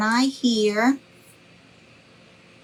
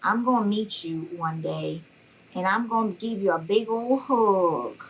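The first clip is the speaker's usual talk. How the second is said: usual speaking voice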